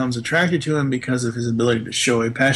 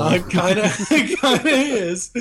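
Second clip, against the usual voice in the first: laughing tease; 6 semitones higher than usual. Real, not acted. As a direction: needle him while laughing. Teasing through laughter.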